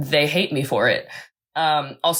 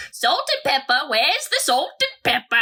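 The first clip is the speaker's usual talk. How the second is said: put-on much higher old lady voice, demanding; crotchety old lady; 9 semitones higher than usual. Co-host imitating cranky old lady at campsite, higher. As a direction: nag like a cranky old woman at camp. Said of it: "crotchety old lady ... she goes".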